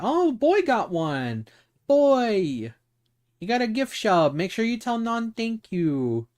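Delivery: high, delighted gushing; excited squeal. Real, not acted. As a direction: gush over the gift like a proud friend. Excited gift sub reaction.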